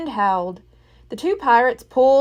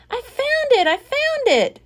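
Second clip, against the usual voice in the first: much higher excited cry; 3 dB louder than usual. A narrator's reading. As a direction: burst out with joy. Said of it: "cried Jack".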